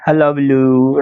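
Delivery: हल्ला बलू with the final oo held long; pronunciation demo. Shown as a demonstration. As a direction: model हल्ला बलू evenly, stretching its end. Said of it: "इसका pronunciation मैं आपको बता दूं".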